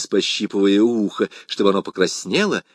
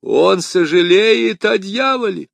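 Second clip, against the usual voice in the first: aghast priest; 9 semitones higher, 6 dB louder than usual. A narrator's reading. Jesuit echoes in horror.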